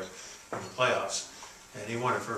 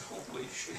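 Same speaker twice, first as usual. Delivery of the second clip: whispered mock amazement, laugh close behind; barely voiced, imitated shock; stunned hush; 11 dB quieter, slower than usual. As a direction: replay the awestruck whisper for laughs. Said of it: "Kind of like that".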